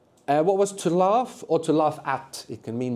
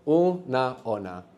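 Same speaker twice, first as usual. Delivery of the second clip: syllables held and separated; slow sounding-out; much slower than usual. Shown as a demonstration. Teacher repeating the answer for students, slowly.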